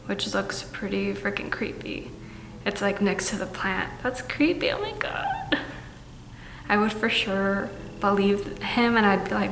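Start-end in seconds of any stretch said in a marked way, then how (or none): 4.0-5.6 rising, high-pitched shock; creeped out. Real, not acted. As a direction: squeal it, spooked. Reacting to creepy drawing.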